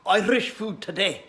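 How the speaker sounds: a jokey put-on Irish accent; a cheerful Irish accent greeting the viewers. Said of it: "enough with the Irish accent".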